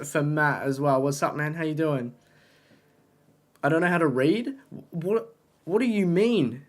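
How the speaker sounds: ordinary talk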